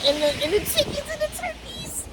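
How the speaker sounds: very high sing-song voice; talking to the birds. Real, not acted. Greeting farm birds in a very high baby-talk voice. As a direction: coo up high at the chickens and turkeys.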